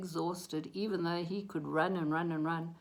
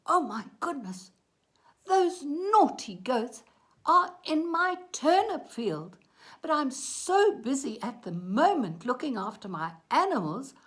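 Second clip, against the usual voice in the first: louder, exasperated farmer voice; flustered farmer; 5 semitones higher, 9 dB louder than usual. A narrator's reading. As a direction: fret loudly as an overworked farmer.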